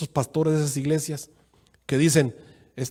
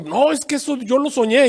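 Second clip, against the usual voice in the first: high, louder defensive insistence; insistent member; 9 semitones higher, 5 dB louder than usual. Retold or imitated. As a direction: defend the dream stubbornly. Miembro insiste en su sueño. (insistencia defensiva, más aguda y fuerte)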